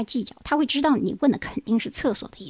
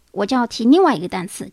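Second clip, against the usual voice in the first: ordinary talk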